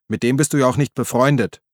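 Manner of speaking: usual speaking voice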